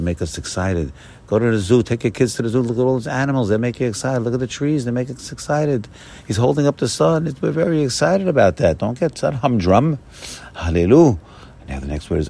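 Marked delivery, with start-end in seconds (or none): 1.3-10.0 excited preaching. Acted. Rabbi urges excitement about creation. 10.5-11.2 a big exultant hallelujah; loud hallelujah. Acted. Exclamation after building excitement.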